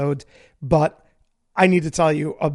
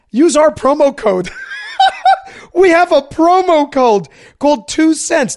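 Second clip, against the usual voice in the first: high, loud laughing through the words; 10 semitones higher, 5 dB louder than usual. Real, not acted. Host cracking up while giving the promo code, laughing. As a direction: giggle your way through it.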